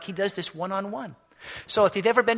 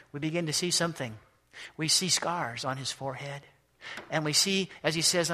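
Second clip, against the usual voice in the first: ordinary delivery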